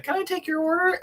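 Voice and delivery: drive-thru speaker. Retold or imitated. A cartoon's drive-thru speaker asking for an order, high voice. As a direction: play the tinny voice at the order box. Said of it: "the speaker being like".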